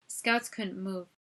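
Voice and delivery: ordinary delivery, nothing marked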